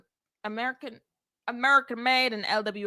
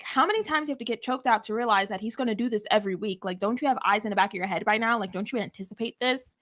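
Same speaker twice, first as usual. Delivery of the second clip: fast, incredulous scolding; exasperated rant; faster than usual. Retold or imitated. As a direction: rattle off the frustration. Own exasperated rant retold.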